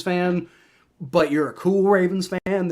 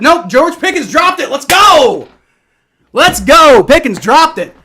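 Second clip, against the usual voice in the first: much higher and louder, cheering the drop; excited yell; 9 semitones higher, 11 dB louder than usual. Real, not acted. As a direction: celebrate like the game just turned. Rival receiver drops the deep pass.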